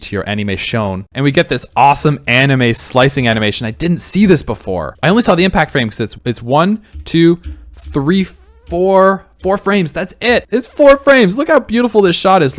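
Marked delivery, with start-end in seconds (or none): 6.4-12.6 excited. Real, not acted. Excited counting of frames.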